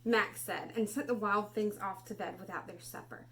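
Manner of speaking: put-on expressive read-aloud for children; storybook reading. Teacher reading Where the Wild Things Are to class, expressive.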